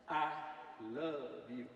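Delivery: soft, low and lingering on love; tender I love you. Pretend. Speaker to audience, a soft tender declaration. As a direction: say it gently to the whole crowd. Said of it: "some of you felt the love".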